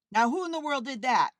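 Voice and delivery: loud, fast, startled exclamation; exclaimed. A narrator's reading. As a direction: demand it sharply, fast and loud. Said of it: "he exclaimed".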